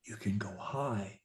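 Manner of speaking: whispered aside. Shown as a demonstration. Coach whispering a reminder. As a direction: breathe it unvoiced. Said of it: "I also said".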